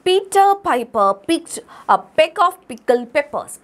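slow, hitting every P sound; slow alliteration demo. Shown as a demonstration. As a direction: spell out each P for the class. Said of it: "അപ്പൊ നോക്കിക്കേ".

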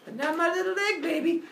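high, whiny sulk; hammed-up pouty complaint; pouting. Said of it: "he starts pouting around".

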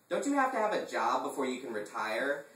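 a touch of snide sarcasm; sniping. Acted. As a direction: snipe at him. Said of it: "I sniped".

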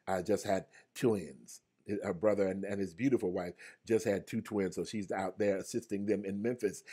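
usual speaking voice

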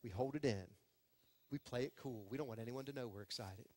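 ordinary talk

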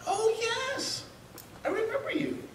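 put-on high, soft eerie old man voice; lightly hammed-up strange stranger, high and soft; high, quiet, creepy voice for the man from the hot tub.

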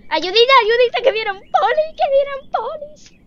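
high, loud squeal for help; playful panic. Real, not acted. Player calls for help as police approach, squealing.

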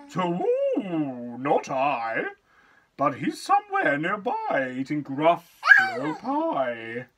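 hooting owl answer, a bit loud; storybook owl voice. Acted.

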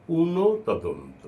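পুনঃ তদন্ত slowly, a short stop after পুনঃ; slow careful pronunciation. Shown as a demonstration. Teacher correcting a mistake: careful slow pronunciation. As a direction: say পুনঃ তদন্ত, clipping পুনঃ before তদন্ত. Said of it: "পুণ্য তদন্ত না".

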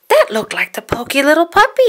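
high, loud little-monster voice; Elmo voice. Acted. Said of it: "said Elmo".

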